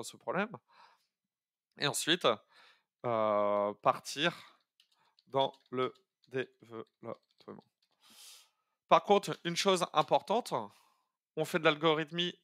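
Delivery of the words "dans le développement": syllable by syllable. Shown as a demonstration. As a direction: break "dans le développement" into separate syllables with gaps. Teacher spaces out the next step.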